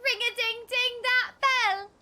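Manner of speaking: high, playful put-on British; mock British accent. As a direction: ring it out as a pretend Brit. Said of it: "us British people say".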